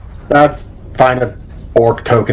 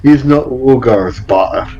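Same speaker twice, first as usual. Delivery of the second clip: bad, slow Russian accent on an orc; 2 semitones higher, slower than usual. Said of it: "my accent's terrible".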